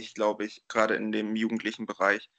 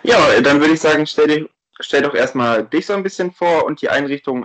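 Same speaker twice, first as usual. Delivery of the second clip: clipping distorted mic; 3 semitones higher, 10 dB louder than usual. Real, not acted. Mic still overdriven after warning.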